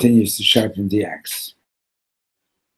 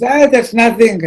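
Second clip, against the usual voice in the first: much higher and louder, brushing off; dismissive; 14 semitones higher, 9 dB louder than usual. Retold or imitated. Gravedigger dismissing the preacher's sins, high and loud. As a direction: wave it away breezily.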